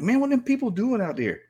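high-pitched retold bewilderment; baffled onlooker. Newcomer watching people wade out in Texas, puzzled. Acting out his puzzled reaction to waders.

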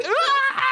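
very high mock shriek; high-pitched hammed-up scream; imitated scream.

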